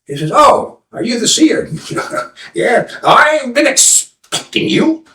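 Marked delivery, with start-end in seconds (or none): 0.3-1.7 put-on high, slow, eager young seeker; eager young man. Young man asking the old seer, high and eager. 2.5-5.1 high, slow mock-mystic reply; drawn-out knowing answer; lightly voiced old seer. Old seer greeting the young man, slow and knowing.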